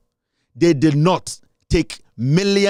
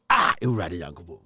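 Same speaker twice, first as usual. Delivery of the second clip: breathy whispered aside, much softer; 13 semitones lower, 8 dB quieter than usual. Real, not acted. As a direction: lean in and whisper the question. Sudden hushed aside.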